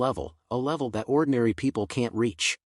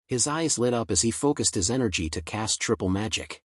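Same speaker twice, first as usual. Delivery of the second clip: ordinary delivery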